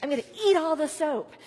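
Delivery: loud, greedy delight; dog thrilled about eating the soap; excited dog voice. Retold or imitated. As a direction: voice the dog gleefully. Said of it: "the dog is like".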